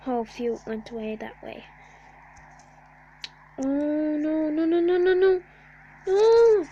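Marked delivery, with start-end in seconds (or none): 3.6-6.7 from worried to loud high shriek; panicked. Real, not acted. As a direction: build from dread to a scream. Building panic as disaster hits.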